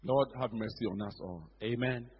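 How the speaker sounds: soft, low prayer; a hushed plea to God. Real, not acted. Preacher breaking into a short quiet prayer.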